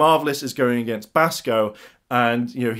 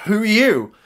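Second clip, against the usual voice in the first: higher, louder wary question; startled challenge; 8 semitones higher, 5 dB louder than usual. Acted. Rangers to the returned Chip, startled. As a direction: confront a stranger who just appeared.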